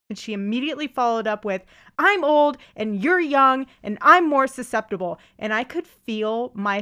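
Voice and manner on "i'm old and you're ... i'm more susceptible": louder, higher mock indignation; heated complaint, acted out; retold angry retort. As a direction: imitate an angry stranger snapping back. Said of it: "You seem extremely angry right now".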